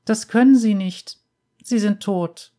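put-on high small girl's voice, matter-of-fact; a child stating it plainly, pitched high; little girl voice. A narrator's reading. Little girl tells the bounty hunter he's dead, high girl's voice.

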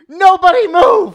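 slow, high commanding yell; frantic shouting hostage taker. Retold or imitated. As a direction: freeze the room with a shout. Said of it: "and I said".

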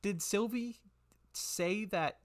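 put-on high, flustered little-girl voice; little girl shopkeeper. Twitch realises he guides the town, high flustered girlish voice. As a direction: work it out aloud as an excitable girl.

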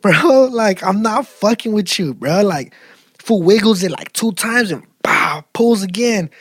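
normal talking voice

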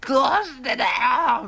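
high desperate wailing cry; Fujiwara Tatsuya impression. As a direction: scream it out in anguish. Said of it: "のやつね".

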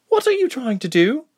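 exclaimed. Acted. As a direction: recoil and exclaim in alarm. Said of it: "the blonde exclaimed".

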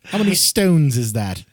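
slow, loud put-on British accent. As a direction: ask it as a mock Brit. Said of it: "there's other British accents".

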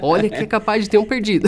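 chuckling through the line; joking with a laugh in the voice. Real, not acted. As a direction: laugh while saying it, amused. Joking about a lost namesake.

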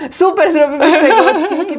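laughing through the line. Shown as a demonstration. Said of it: "Weź to, [name], na szybko po koreańsku powiedz".

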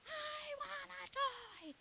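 put-on high, drawn-out toddler wail; put-on screechy toddler demand; tantruming child. Screaming toddler voiced in retold store tantrum.

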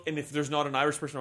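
ordinary talk

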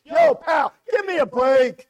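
hammed-up irritated yell; mock annoyed guy. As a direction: shout him off like a fed-up street guy. Caricaturing the annoyed churchgoer.

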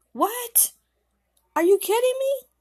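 very high, incredulous outburst; shocked disbelief. A narrator's reading. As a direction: act out stunned disbelief, rising to loud. Friend hears of the death.

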